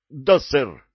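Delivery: loud, high military acknowledgement; shouted answer. A narrator's reading. Recruit confirming to the sergeant, shouting. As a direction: snap back loudly to a superior.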